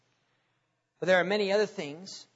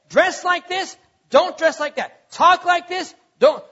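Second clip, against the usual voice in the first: raised pitch, loud, alternating orders; 7 semitones higher, 7 dB louder than usual. Acted. Preacher voicing scripture's rules to believers, emphatic commands. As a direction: fire off firm do-and-don't rules.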